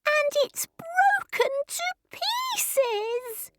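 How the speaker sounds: very high, slow squeaky wail; squeaky, drawn-out little bear sobbing; sobbing Baby Bear. A narrator's reading. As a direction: lament it like a tearful tiny cub. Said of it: "he sobbed".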